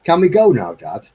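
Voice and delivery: put-on high, loud bored kid whine; whiny child. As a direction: beg to leave like a bored kid. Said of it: "next to you saying".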